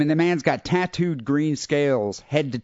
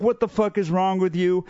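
retold angry outburst; 5 semitones higher than usual. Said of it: "he got really pissed".

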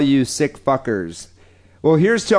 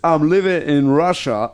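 living said with a put-on Russian accent; Russian in a mock Russian accent. Shown as a demonstration. Host redoes the email line in a Russian accent. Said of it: "Could you do this in a Russian accent".

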